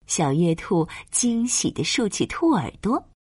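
ordinary delivery

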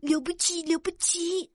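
softly voiced admiration; admiring murmur. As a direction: marvel softly, as one of the crowd. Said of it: "大家感叹道".

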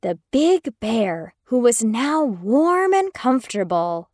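usual speaking voice

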